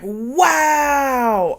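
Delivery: loud exasperated wow; flipping out. Retold or imitated. Rob losing it at Sophie, loud exasperated wow. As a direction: blurt it out loud. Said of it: "Rob flips".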